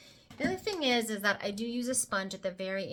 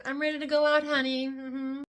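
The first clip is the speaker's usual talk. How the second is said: sassy pride; 5 semitones higher, 7 dB louder than usual. Acted. Admiring her finished concealer look.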